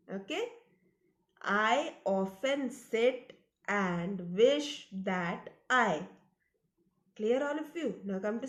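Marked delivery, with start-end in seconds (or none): 1.4-6.0 slow, word-by-word model reading; read-along recitation. Shown as a demonstration. Teacher reads the first line for kids to repeat, slow read-along recitation. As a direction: recite it slowly for children to follow. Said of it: "Now let's read the first line".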